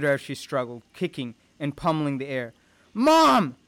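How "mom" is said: high, loud cry of alarm; anguished scream. Acted. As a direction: scream out for your mother. Son sees mother seized.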